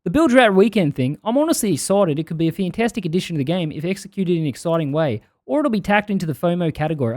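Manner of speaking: shaky attempt at a New Zealand accent; put-on Kiwi voice drifting into Australian. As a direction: read it as a Kiwi, sliding towards Aussie. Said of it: "I'm gonna give him a Kiwi accent".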